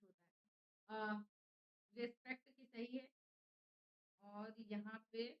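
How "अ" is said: ordinary talk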